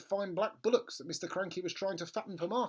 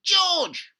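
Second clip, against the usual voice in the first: high, loud screech of a name; grandma screeching at George; yelling; 13 dB louder than usual. Acted. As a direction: shriek his name. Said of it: "Grandma yelled".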